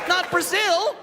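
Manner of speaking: high comic shout; shrill-free high yell of protest; mock shout. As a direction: yelp it out in disbelief. Comic outburst about Brazil.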